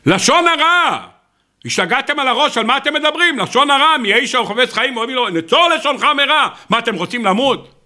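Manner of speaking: high-pitched, agitated outburst; alarmed protest. Retold or imitated. Man scolds friends over gossip, acted horrified protest.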